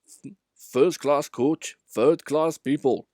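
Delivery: slow, loud grumble of a sniffy coach; a put-out carriage grumbling slowly at the passengers. A narrator's reading. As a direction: drag the words out in disdain. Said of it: "would grumble".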